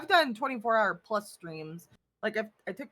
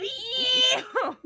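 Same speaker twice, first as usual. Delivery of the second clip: high disgusted ew; 12 semitones higher, 4 dB louder than usual. Acted. Leaving, disgusted.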